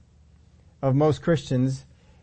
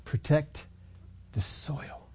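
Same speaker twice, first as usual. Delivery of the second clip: whispered punchline; 4 semitones higher, 8 dB quieter, much slower than usual. Real, not acted. Sermon punchline, dropped to whisper.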